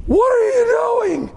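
startled dad shout. Retold or imitated. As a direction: bark it like a dad walking in on chaos. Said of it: "and I went".

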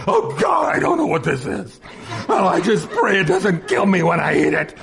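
hammed-up desperate prayer; mock panicked prayer. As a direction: plead comically for your life over dinner. Acts panicked prayer over strange food.

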